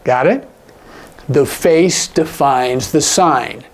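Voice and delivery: ordinary talk, nothing marked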